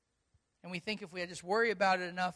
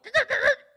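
burst of laughter; 15 dB louder than usual. Real, not acted. Amazed at window.

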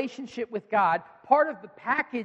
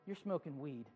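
much softer incredulous mutter; disbelieving aside; 7 semitones lower, 17 dB quieter than usual. Retold or imitated. Pastor reacting to the coach in mild disbelief. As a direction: toss it off in quiet disbelief. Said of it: "Craig's exact response was".

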